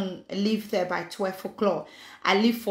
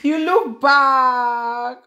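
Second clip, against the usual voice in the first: loud call; 4 semitones higher, 9 dB louder, much slower than usual. Real, not acted. Calling out to viewer.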